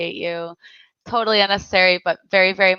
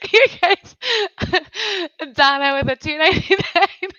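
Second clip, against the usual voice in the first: high voice breaking up with laughter; real, tearful laughing fit, pitched high; laughing to tears; 6 semitones higher, faster than usual. Real, not acted. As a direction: struggle to read through helpless giggles. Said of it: "I'm crying".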